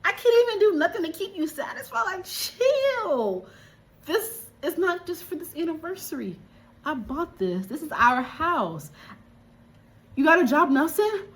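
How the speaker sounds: a high, put-on exasperated husband voice. Husband telling his wife to chill, high exasperated voice. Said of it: "homie is like".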